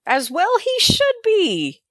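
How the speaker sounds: high, slow, put-on voice; mock solemn high voice. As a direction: draw it out high in a funny put-on voice. Mock grave remark.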